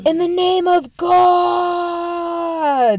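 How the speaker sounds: long, high, drawn-out shout; battle cry. Retold or imitated. Joan charging the English, drawn-out battle cry. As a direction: scream it as you charge forward.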